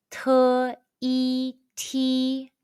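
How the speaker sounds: "Te, e, ti" each held high and level; high-pitched first tone. Shown as a demonstration. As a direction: blend "Te" and "e" into "ti", sustained on one high note. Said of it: "the high-pitched first tone all together".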